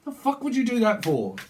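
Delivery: high-pitched indignant demand. Acted. Voicing someone indignant after argument.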